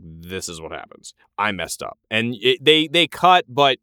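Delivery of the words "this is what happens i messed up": low, much softer mock confession; subdued, retold admission. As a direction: own up quietly, as if quoting him. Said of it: "he kinda just confesses".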